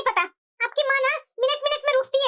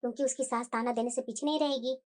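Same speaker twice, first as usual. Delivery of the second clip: much lower, much softer inner monologue; soft thinking aloud; 13 semitones lower, 9 dB quieter than usual. Acted. Mother-in-law planning gold for her daughter, soft private murmur. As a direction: plan it quietly to yourself.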